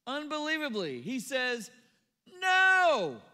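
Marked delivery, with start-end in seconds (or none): 2.4-3.2 loud, high, forceful refusal; emphatic no. Retold or imitated. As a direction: shout the no at Jesus. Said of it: "declared emphatically".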